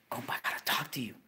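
hushed, fast, frightened; a scared friend on the phone, whispering fast. Retold or imitated. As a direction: whisper it quickly like she's afraid. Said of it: "All scared".

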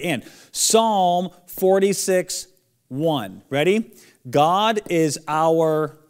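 slow and loud, leaving pauses for missing words; teacher leaving blanks in the verse for kids to say; slow recitation with gaps to fill. Shown as a demonstration. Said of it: "Say it now. Fill it in".

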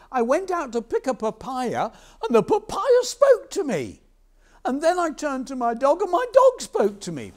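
high, loud, frantic babble; panicked farmer. Acted. Farmer tells fisherman what spoke to him, high panic. Farmer breathlessly reports talking things.

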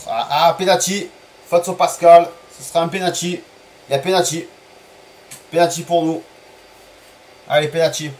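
thrilled streamer calling a penalty; excited penalty call. Real, not acted. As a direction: call the penalty like it just changed the game. Penalty given in the match.

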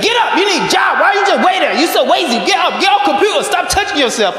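put-on high-pitched scolding nag in a Japanese accent; angry girlfriend's Japanese-accented tirade. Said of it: "I do the accent because she's from Japan".

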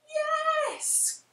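high, bright cry of yes; eager high yes; cried yes. A narrator's reading. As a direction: welcome him aboard gladly. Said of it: "cried the witch".

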